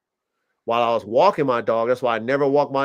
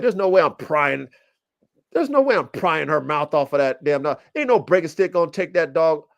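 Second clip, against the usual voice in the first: normal talking voice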